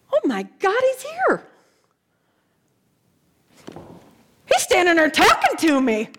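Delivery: high, astonished gasp of joy; acted burst of stunned excitement; amazed recognition. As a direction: realise in wonder that it's really him. Mary realises Jesus is alive.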